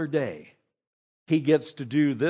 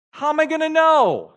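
high, loud doubting cry; incredulous question; 13 semitones higher, 7 dB louder than usual. Retold or imitated. Zechariah questioning the angel, high and loudly incredulous. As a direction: demand it in disbelief.

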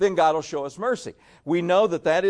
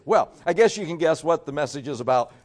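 normal talking voice

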